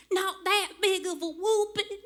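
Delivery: put-on playful child voice, pleading sweetly; cute little sister voice. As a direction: squeak it out like a cheeky fourteen-year-old. Little sister quoted mid-spanking.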